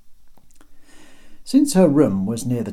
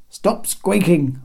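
slow, higher, louder bark; barked order; 9 semitones higher, 6 dB louder, much slower than usual. Acted. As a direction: snap the order out slowly. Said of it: "barked Genikos".

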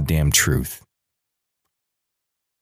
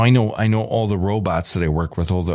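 usual speaking voice

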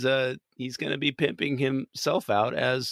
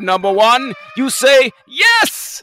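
loud, higher captain bellow; captain shout; 11 semitones higher, 9 dB louder, slower than usual. Acted. Captain impression, shouted answer.

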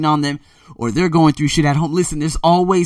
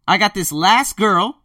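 usual speaking voice

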